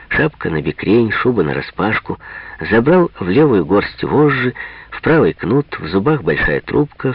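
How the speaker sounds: usual speaking voice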